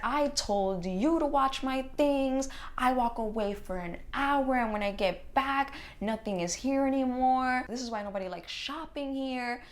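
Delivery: hammed-up angry customer; imitating angry customer. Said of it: "Just going off and off".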